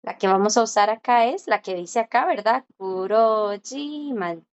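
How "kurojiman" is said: "Kurojiman" drawn out slowly, syllable by syllable; silent J. Shown as a demonstration. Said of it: "no se lee".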